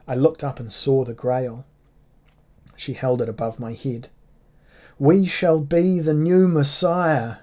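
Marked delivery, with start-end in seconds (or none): 5.0-7.4 slow, high and loud, like a ringing bell; ringing golden voice. Acted. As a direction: proclaim it like a golden bell tolling. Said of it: "Her voice rang like a golden bell".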